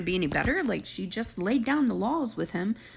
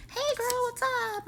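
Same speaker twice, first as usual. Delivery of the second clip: much higher mock friendly greeting; mock Alyssa greeting. Vlogger imitating Alyssa calling Savannah, much higher mock greeting. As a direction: pitch it way up, imitating a bubbly caller.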